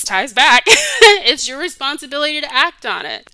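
real laugh mid-sentence. Real, not acted. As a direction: let a laugh burst through the words. Breaks up laughing mid-point.

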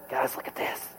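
hushed whisper to classmates; sneaky classroom whisper. Retold or imitated. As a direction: whisper it so the teacher can't hear. Showing off tattoo during class.

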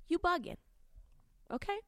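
"You bugging" jumps up high and punchy; high emphatic call-out. Acted. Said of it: "That's what I would like to say".

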